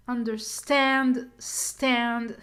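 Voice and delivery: Understand slowly, STAND punched and held; slow test with a strong final STAND; stress on STAND. Shown as a demonstration. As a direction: hit STAND in Understand, then stand alone. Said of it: "it's an under, under here, schwa".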